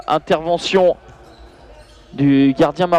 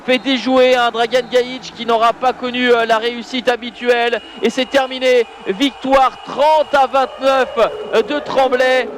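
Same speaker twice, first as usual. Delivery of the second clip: excited play-by-play; 6 semitones higher than usual. Real, not acted. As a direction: call the final save with full excitement. Final save and final whistle.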